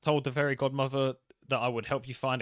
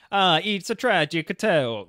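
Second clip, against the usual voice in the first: put-on slow, louder, higher Italian mobster accent; 5 semitones higher, 8 dB louder, slower than usual. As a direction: lament it like a dramatic Italian godfather. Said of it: "you gotta do an Italian accent".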